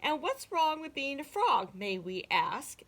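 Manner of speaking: offended frogs talking back; affronted exclamation. A narrator's reading. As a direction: start high and loud, demand an answer. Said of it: "exclaimed the frogs".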